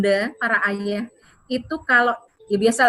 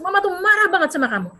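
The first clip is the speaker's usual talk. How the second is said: high, loud angry scolding; 8 semitones higher, 3 dB louder than usual. Shown as a demonstration. Mother showing how a loud voice loses control, shouting high. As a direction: raise your voice at the child. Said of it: "mengeluarkan suara yang keras".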